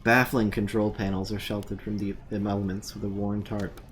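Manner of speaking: low, even game narrator voice; scene narration. A narrator's reading. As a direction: describe the scene like a game master.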